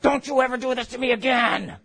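high shouted yell; screaming mother in labor. Retold or imitated. Woman in labor yelling at her husband, high and shouted. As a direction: yell at the husband mid-contraction. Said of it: "they're like".

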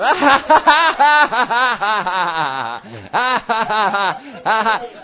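long, loud ha-ha belly laugh; uproarious mock laugh. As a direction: laugh the bill collector down. Laughs at the imagined creditor.

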